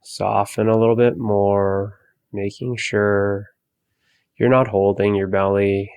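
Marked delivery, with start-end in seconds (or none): none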